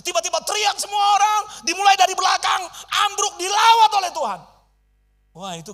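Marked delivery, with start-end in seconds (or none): 0.0-4.4 high, loud preaching climax; excited shout. Real, not acted. Preacher tells congregation the floor collapsed, excited shout. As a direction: shout out the revival moment.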